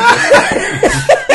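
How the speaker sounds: high silent wheezing laugh; breathless wheeze laugh. Real, not acted. Cracking up over the accent turning Irish, wheezing laugh. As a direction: laugh so hard no voice comes out.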